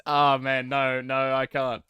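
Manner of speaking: loudly abandon the attempt; flustered protest; exasperated. Real, not acted. Gives up on failed impression.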